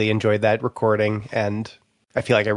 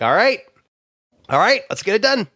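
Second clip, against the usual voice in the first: higher-pitched rallying self-talk; self-motivating resolve; 9 semitones higher than usual. Retold or imitated. Retelling talking himself into finishing projects, rallying resolve. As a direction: psych yourself up to start. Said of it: "So I'm like".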